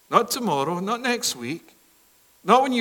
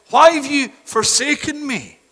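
higher, louder cry of abandonment; Christ's cry from the cross; anguished cry; 7 semitones higher, 6 dB louder than usual. Acted. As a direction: cry it out to the heavens.

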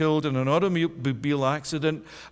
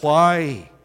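a louder, pleading why; crying out; 8 dB louder than usual. Acted. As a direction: cry it out to heaven. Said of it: "he cried out".

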